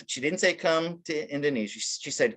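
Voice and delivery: ordinary delivery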